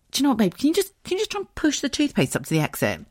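high, sweet wheedle to a partner; coaxing request. Retold or imitated. Wife asking her husband to fix the toothpaste, coaxing and high. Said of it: "But I said".

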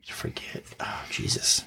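whispered to herself while choosing. Real, not acted. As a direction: breathe the words, thinking aloud. Mulling cards under her breath.

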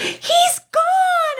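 high, excited toddler imitation; mock-child cry of delight, very high; happy little boy. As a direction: squeal it triumphantly like a small boy. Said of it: "he was so happy".

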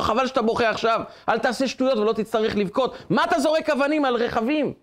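high, indignant telling-off; mock-stern rebuke rising in pitch; acted scolding. Driver tells crying boy off for throwing stones, acted scolding.

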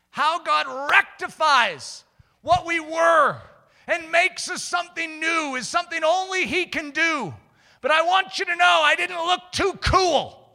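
high, loud, driving sermon voice; impassioned preaching. Real, not acted. Preacher warning the congregation about sin, impassioned. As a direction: press the warning home with force.

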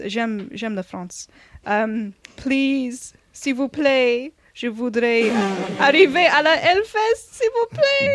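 put-on begging in English-accented French. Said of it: "that's me begging".

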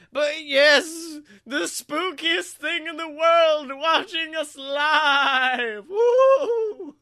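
loud, high, slow hype; hyped announcer. Acted. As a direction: sell it to the crowd. Hyped announcement of live stream.

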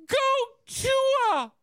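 very high mocking girly voice; squeaky imitation of a fan; mocking Linda voice. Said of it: "She writes".

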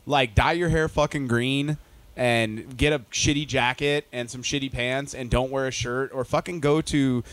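ordinary delivery

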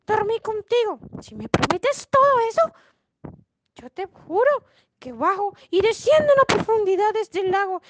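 high-pitched storybook frog voice; refuse, then bargain as a fussy frog; high, emphatic frog character; demanding frog. Acted. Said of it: "La rana le respondió".

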